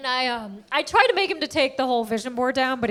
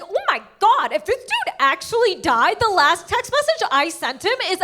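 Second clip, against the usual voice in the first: hammed-up oh-my-God alarm; alarmed realisation; 6 semitones higher than usual. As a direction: play up the dawning dread, pitched high. Realises her threat may incriminate her.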